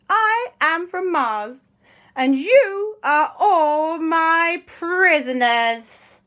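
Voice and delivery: high, loud, very slow alien proclamation; villainous Martian. Acted. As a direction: stretch every word like a gloating space invader. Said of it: "the guy, the creature, rather, popped out".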